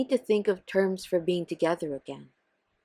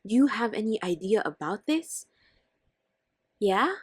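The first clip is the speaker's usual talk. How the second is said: ordinary talk, nothing marked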